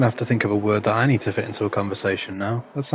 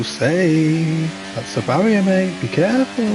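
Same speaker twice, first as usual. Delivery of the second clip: higher, excited warning; rising warning; 7 semitones higher, 4 dB louder, slower than usual. Real, not acted. Streamer warning a driver near the barrier, excited. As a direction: warn him as he nears the wall.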